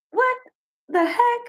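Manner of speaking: high-pitched, halting disbelief; baffled. Retold or imitated. Woman reacts to the nasty stare, high and baffled. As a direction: break it up with pauses, voice jumping high.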